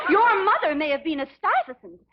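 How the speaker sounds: angry retort. Acted. Mother snaps back at the snobbish Elizabeth, angry. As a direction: fling it at her, indignant.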